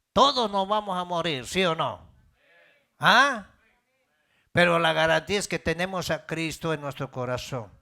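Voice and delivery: loud, high impassioned preaching; preaching at full force. Acted. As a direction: thunder it out to the congregation.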